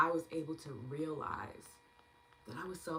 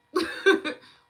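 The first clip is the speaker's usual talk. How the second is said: laughing; 13 semitones higher, 14 dB louder than usual. Real, not acted. Laugh after quote.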